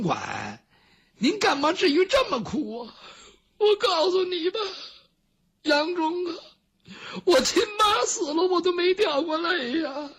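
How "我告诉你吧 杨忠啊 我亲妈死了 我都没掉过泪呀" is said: high, weeping voice, still sobbing; mourning eunuch weeping to Yang Zhong; tearful lament. Acted. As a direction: cry out the words between sobs. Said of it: "这郭槐哭起来了".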